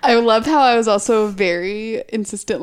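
usual speaking voice

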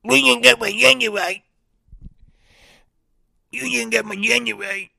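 high, distorted whiny mimicry; mock them in a squawky high put-on voice; exaggerated high-pitched griping caricature; mocking complainers. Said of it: "they're like".

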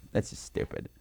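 whispered aside; hushed whisper. Shown as a demonstration. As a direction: breathe it out voiceless. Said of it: "self-repeat".

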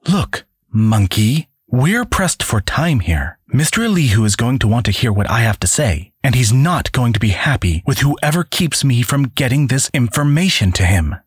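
impatient Cassandra. Acted. Cassandra scolds the guard.

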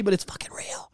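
hushed whisper. Real, not acted. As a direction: drop to a whisper. Whispered end of rant.

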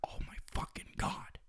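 imitating breathless, voiceless disbelief; hushed, astonished reaction; stunned whisper. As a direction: whisper it as if floored by the news. Friend's retold stunned whisper.

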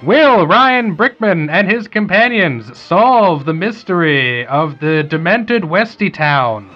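put-on slow, booming old radio announcer; hammy serial cliffhanger narration. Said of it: "Do the radio voice".

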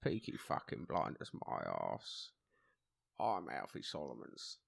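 low gangster impression, partly voiceless; Alfie Solomons. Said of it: "I can do Alfie Solomons".

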